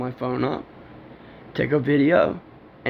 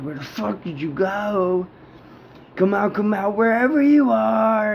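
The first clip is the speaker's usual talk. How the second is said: taunting call; 6 semitones higher than usual. Retold or imitated. Calling out to the hiding figure, mocking sing-song dare. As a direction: taunt it like a kid's hide-and-seek, building up.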